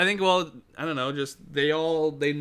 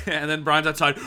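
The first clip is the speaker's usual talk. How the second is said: ordinary delivery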